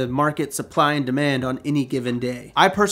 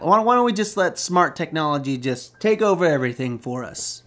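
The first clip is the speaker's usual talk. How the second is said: normal talking voice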